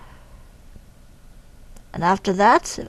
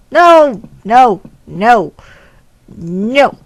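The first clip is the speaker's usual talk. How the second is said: much louder, repeated no; loud protest; 8 dB louder, much slower than usual. Real, not acted. Player yelling as the game goes wrong. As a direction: cry out no in alarm.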